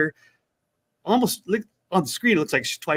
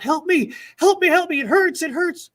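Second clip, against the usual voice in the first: high pleading voice in mock pain; retold cry for help; 13 semitones higher, 4 dB louder than usual. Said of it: "she's crying out".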